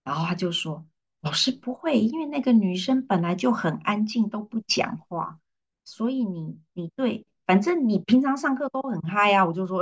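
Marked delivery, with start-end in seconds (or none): 1.2-9.4 higher-pitched acted student voice; a light take on an eager student; imitating a student. Student voiced in retold story.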